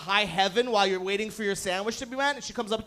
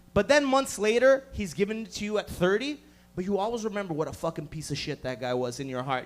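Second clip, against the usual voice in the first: ordinary talk, nothing marked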